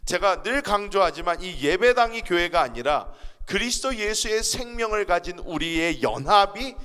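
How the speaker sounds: ordinary talk, nothing marked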